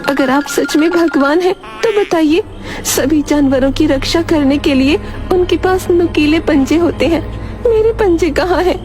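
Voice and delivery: high cow voice, testing the god; challenging cow. Acted. Cow asks God to prove himself, challenging. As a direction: demand proof in a high-pitched character voice.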